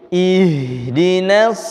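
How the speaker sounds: ih with a long airy h, slowly; slow model of ihdinas with a flowing h. Shown as a demonstration. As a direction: let the h of ih flow, unbroken. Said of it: "saya contohkan kembali".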